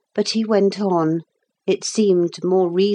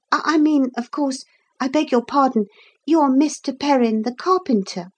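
put-on high, stumbling boyish apology; flustered polite boy; 7 semitones higher, faster than usual. Boy fixing his rudeness to the carpenter, flustered and polite. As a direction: correct yourself politely, pitched up.

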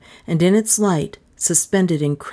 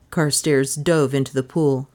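plain reading voice

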